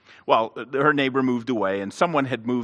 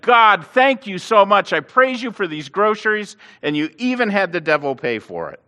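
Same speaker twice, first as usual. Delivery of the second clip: gleeful thanks; 8 semitones higher, 6 dB louder than usual. Retold or imitated. Lady's gleeful retort.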